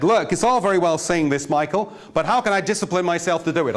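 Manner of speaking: high-pitched mock American accent; dreadful American accent. Course student challenging her tutor, in a bad American accent. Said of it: "that was a dreadful accent".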